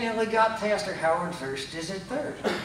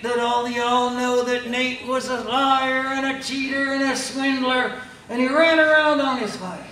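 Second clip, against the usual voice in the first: building sermon voice; preaching; 4 semitones higher, 4 dB louder than usual. Retold or imitated. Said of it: "and he says".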